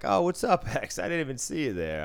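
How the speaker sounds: high, loud fake-surprised greeting; mock innocence. Greeting the Yankee fan just needled, mock-innocent. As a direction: act as if you hadn't noticed him.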